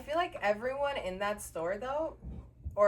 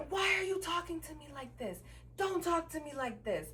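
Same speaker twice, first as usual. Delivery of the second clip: imitating his yelling; 8 semitones higher than usual. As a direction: mimic his indignant yell without the volume.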